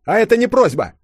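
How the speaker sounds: high, loud bark; barked order. A narrator's reading. Yulia orders the grand duchess along, barking. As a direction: bark it, allowing no argument. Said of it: "рявкнула Юля".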